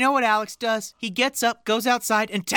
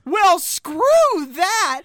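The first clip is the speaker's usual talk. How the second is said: slow, loud "Well, screw that", a bit higher; dubbed version; 7 semitones higher, 4 dB louder, much slower than usual. Retold or imitated. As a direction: stretch out "screw" like a dubbed line. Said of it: "to make it sound like she says".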